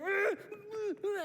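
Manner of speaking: high mock grunts of frustration; imitated frustrated toddler. Dad imitates his son stuck on a buckle, frustrated grunting. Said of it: "man, he just loses it".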